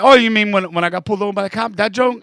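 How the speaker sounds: loud, fast flustered answer; blurting it out, caught off guard; flustered reply. Retold or imitated. Comedian to his mentor, loud flustered blurt.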